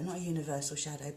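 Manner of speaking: ordinary delivery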